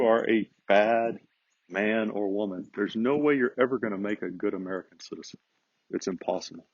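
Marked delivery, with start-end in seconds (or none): none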